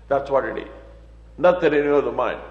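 usual speaking voice

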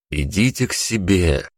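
ordinary delivery, nothing marked

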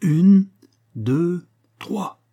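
high countdown, loud on the first; counting out. A narrator's reading. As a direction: call the count before a dive. Diver counts before plunging. (compte à voix haute avant de plonger)

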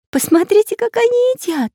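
put-on very high, delighted girl voice; amused little girl. A narrator's reading. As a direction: pipe it up, amused. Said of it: "засмеялась Настенька".

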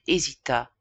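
usual speaking voice

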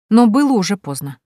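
ordinary delivery, nothing marked

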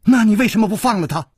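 high, fast outburst; rapid high-pitched confrontation; loud demand. A narrator's reading. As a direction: burst out with a high, urgent question. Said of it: "柳无眉忽然冲过去大声地说".

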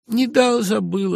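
high, loud and careless; careless excuse. A narrator's reading. Simonov careless, not even apologizing to the narrator. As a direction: toss it off with no remorse.

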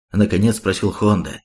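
ordinary delivery

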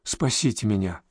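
quiet voiced near-whisper; hushed whisper. A narrator's reading. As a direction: plead under your breath. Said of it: "и прошептала".

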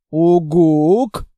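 loud odd rising hoot in a strange voice; creature hoot. Acted. Monster hoots a long question to the girl.

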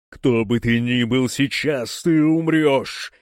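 slow, growling demon threat; growled warning. A narrator's reading. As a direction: snarl it like a demon. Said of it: "прорычала демон Евангелина".

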